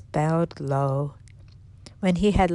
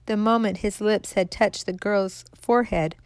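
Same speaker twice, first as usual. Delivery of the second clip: plain reading voice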